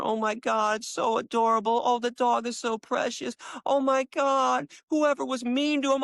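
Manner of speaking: mock-swooning commenter, slowing on "Oh my God"; gushing commenter. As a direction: gush like a doting fan. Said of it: "all the comments were".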